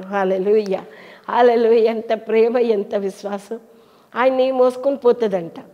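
ordinary talk

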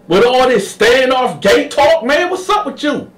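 high, loud, indignant rant; high furious outburst; Sweet Lo angry. Retold or imitated. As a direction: turn on him hard. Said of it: "demeanor changed".

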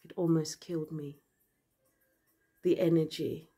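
usual speaking voice

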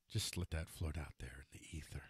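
breathy aside, barely voiced; whisper. Real, not acted. Vlogger to viewers, mocking the quote, in a whisper. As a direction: let it hang in a hushed whisper.

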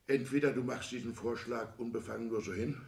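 ordinary talk, nothing marked

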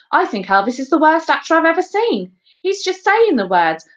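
high-pitched, outspoken pupil; high child's complaint; critical schoolboy. A narrator's reading. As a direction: pipe up with a blunt verdict. Ruskin criticises Elvis before the class.

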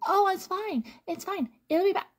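lightly mocking her own naive self; carefree past self. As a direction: brush it off in a high airy voice. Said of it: "I was like".

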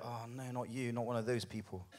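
hushed, put-on weariness; collective weary sigh. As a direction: imitate a congregation groaning under its breath. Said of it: "a collective sigh can be heard".

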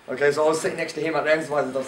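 ordinary delivery

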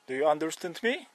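ordinary talk

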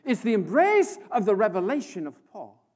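loud, driving sermon voice; full-force preaching. Real, not acted. Preacher pressing his point on the congregation, loud and driving. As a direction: press the revelation home to the crowd.

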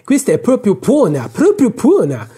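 put-on affected, high Parisian accent; attempted Parisian accent. A narrator's reading. Officer mocking the girl, affected Parisian accent. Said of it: "cercando l'accento parigino".

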